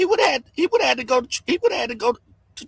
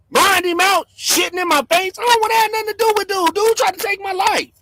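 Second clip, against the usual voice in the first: higher, rushed, heated burst; fast angry outburst; 6 semitones higher than usual. Real, not acted. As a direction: fire it off angrily. Rant peak, fast shouting.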